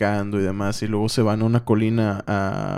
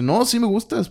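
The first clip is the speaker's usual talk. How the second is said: much higher, pleading denial; protesting reassurance; 11 semitones higher than usual. Retold or imitated. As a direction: rush to reassure, pitch jumping up. Acted hurried reassurance to girlfriend.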